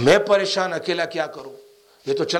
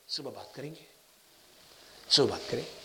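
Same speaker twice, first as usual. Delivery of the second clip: soft soothing; 5 semitones lower, 10 dB quieter than usual. Retold or imitated. Elder softly settling him to sleep.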